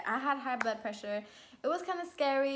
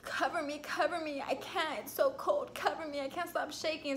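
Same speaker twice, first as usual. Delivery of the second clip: imitated shivering, higher-pitched pleading; lightly acted chills, raised pitch; 4 semitones higher, 4 dB quieter than usual. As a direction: plead as if freezing and shaking. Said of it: "but I was shaking".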